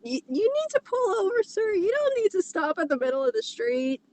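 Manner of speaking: high, quick mock cop voice; police officer voice. Reading fanfic aloud, voicing the cop stopping Shadow, hammed-up high cop voice. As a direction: play the cop pulling someone over, hammed up.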